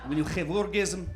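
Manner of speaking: soft, expressionless Russian accent; cold, miserable mock Russian; deadpan comic Russian accent, no expression. Shown as a demonstration. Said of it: "Now, do a Russian accent".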